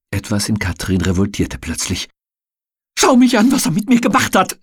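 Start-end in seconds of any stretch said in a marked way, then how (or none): 3.0-4.6 high, loud anguished scream. A narrator's reading. Battered woman screams at her friend, high and loud. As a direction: cry it out, pointing at the bruises. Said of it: "schrie sie".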